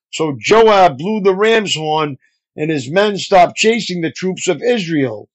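plain reading voice

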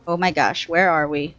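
normal talking voice

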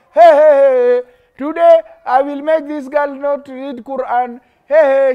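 loud mocking devil voice; Shaitan's taunt. Teacher voicing Shaitan to scare the children, loud mocking taunt. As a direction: crow like a gleeful devil. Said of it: "Shaitan is saying".

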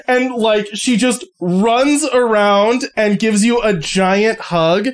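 ordinary talk, nothing marked

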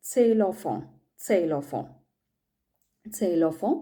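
സെയിലോഫോൺ said as German "Xylophon"; German pronunciation. Shown as a demonstration. Teacher drilling the example word for students. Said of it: "സെയിലോഫോൺ എന്ന് പറയുന്നത്".

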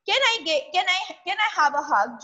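a small son begging his father; little boy asking. Acted. Son asks his father for a hug, childlike and pleading. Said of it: "Jonathan asked".

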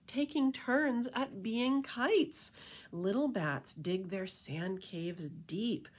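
lilting rhymed storybook read; reading to children. A narrator's reading. Reading a bat beach story to kids, lilting rhyme. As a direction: read it like a bedtime picture book. Reading rhyming picture book aloud.

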